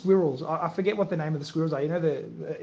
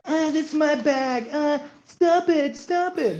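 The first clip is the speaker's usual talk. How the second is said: put-on high, slow whiny kid voice, squabbling; 8 semitones higher, 5 dB louder, much slower than usual. Dad imitating his kids fighting at the door, high and whiny. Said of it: "the way they come into the house is normally".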